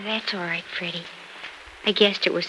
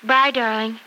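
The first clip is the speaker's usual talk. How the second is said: usual speaking voice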